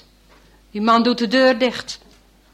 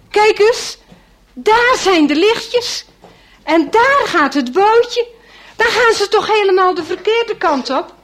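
excited; 9 semitones higher, 6 dB louder than usual. A narrator's reading. Excited pointing at lights.

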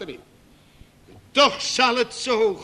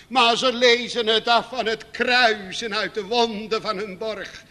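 usual speaking voice

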